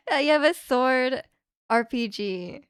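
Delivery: ordinary delivery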